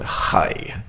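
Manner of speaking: "Hi" with a scraping kh for the H; "Hi" opened on a hard throaty fricative; harsh Russian H. Shown as a demonstration. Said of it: "It's a very harsh sound".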